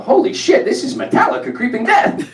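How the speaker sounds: high-pitched excited outburst; retold tipsy outburst; blurted out. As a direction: blurt it out like a sudden revelation. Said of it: "I just blurt out".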